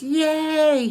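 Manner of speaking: put-on high, loud child's cheer of joy; excited cheer. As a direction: burst out with a little kid's delight. Child hears horses are coming.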